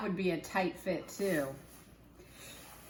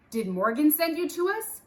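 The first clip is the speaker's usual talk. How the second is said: lightly voiced Jack; 8 semitones higher, 5 dB louder than usual. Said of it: "said Jack".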